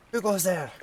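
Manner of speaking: nervous courier voice. Acted. Voicing frightened courier.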